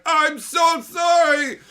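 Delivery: overwrought emo meltdown, wailed out; Teferi sobbing apology. Retold or imitated. Teferi begging Karn's forgiveness, loud mock sobbing wail. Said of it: "Teferi's like".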